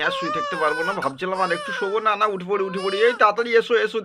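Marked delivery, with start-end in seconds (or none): 3.1-4.0 urgent, higher call; raised hurry-up call. Acted. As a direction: shout for her to hurry. Calling wife to come quickly.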